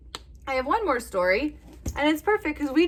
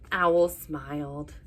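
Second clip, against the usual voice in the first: ordinary delivery, nothing marked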